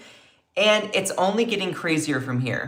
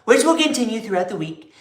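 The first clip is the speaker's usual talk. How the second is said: Trump impression; 3 semitones higher than usual. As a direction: puff up and proclaim like Trump. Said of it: "I can't do my Trump impression".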